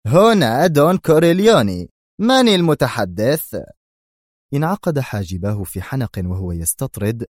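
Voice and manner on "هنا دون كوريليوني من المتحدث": loud, higher bark; impatient boss on the phone; irritable phone answer. Acted. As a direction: snap into the phone. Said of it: "قائلا في عصبية".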